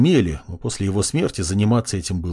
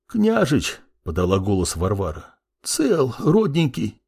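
put-on old servant woman. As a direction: voice a tough old housemaid. Old servant Varvara speaks.

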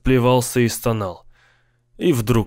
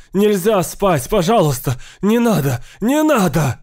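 repeated desperate shouts, pitched up; pleading screams; 7 semitones higher than usual. A narrator's reading. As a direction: beg them to stop, in panic. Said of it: "кричал Сейл".

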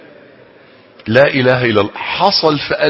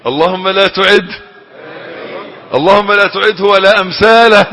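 higher and louder, crying out to God; imitated pleading prayer; 7 semitones higher, 7 dB louder, slower than usual. Preacher voicing people who prayed the ruler not return, loud pleading. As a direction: cry out the plea as if desperate.

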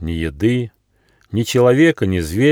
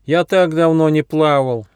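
high whining plea; whiny voice; 6 semitones higher than usual. A narrator's reading. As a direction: whimper it like a spoilt child. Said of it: "плаксивым голосом".

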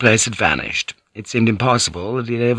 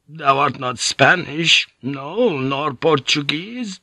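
put-on higher, suspicious old monk voice; a frail old monk turning suspicious; bitter old priest; 7 semitones higher, slower than usual.

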